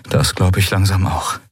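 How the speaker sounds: ordinary talk, nothing marked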